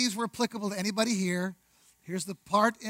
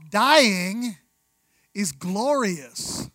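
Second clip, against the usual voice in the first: normal talking voice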